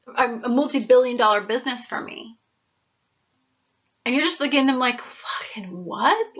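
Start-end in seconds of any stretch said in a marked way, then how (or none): 5.0-6.4 low, soft, slow stunned disbelief; quietly baffled "what"; dumbfounded. Retold or imitated. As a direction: mumble it like you can't believe your ears. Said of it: "you're looking at them dumbfounded".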